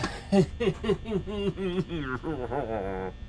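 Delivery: soft, drawn-out chuckle; long laugh. Real, not acted. Player going back into the game, long soft laugh. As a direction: chuckle on and on, trailing downward.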